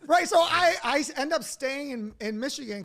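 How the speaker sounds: loud, high laughing talk; laughing through. Real, not acted. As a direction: laugh at how absurd it got. Laughs at the absurd outcome.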